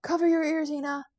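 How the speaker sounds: high, loud cry over the fray; high-pitched shouted warning, easing on the name; urgent warning shout. Acted. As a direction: call out urgently across a battle. Urgent warning above the battle.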